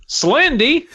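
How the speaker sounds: mewling. Acted. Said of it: "Jeff mewled".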